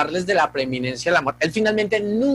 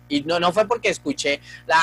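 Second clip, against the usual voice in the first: ordinary delivery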